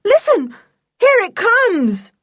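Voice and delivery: high, loud cry of warning; urgent alarm at full voice; frantic yell. A narrator's reading. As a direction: shout it in rising panic, drawing out the end. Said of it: "yelled Julian".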